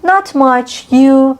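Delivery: "Not much" lifted high and bright, tossed back "You"; friendly reply. Shown as a demonstration. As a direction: answer a friend's what's up cheerfully. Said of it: "یعنی چه خبره؟".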